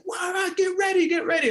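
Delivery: high, urgent shouting, retold; imitated coach yell. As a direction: bark orders like the impatient coach. Coach yelling player to warm up.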